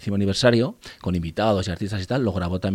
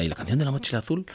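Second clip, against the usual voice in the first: higher, questioning fan voice; imitating asking fans; 6 semitones higher, faster than usual. Fans asking after concerts for the missing song, higher imitated question. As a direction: lightly voice a hopeful customer, pitch lifted.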